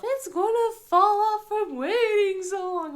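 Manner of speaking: put-on high, loud wailing kid voice; mock crying whine. Mom imitating son wailing, a loud whine. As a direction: wail the complaint like a little boy.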